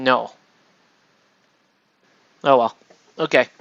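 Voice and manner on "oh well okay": ordinary delivery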